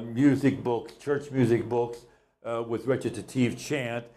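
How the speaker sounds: ordinary delivery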